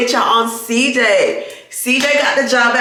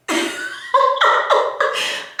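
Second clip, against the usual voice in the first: high breathless silent laugh; 4 dB quieter than usual. Real, not acted. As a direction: wheeze out a high airless laugh. Laughing at son growing up.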